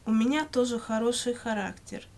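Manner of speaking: normal talking voice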